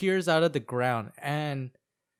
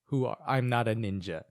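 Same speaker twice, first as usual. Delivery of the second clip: emotionless; 6 semitones lower than usual. Retold or imitated. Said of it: "He's more emotionless".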